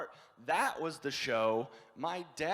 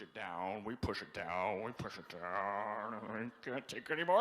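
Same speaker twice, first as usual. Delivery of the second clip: low, slowing, increasingly strained; bottled-up strain; 7 semitones lower, 3 dB quieter than usual. Acted. Midwesterner pushing feelings down, low building strain. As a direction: squeeze it down until it is about to burst.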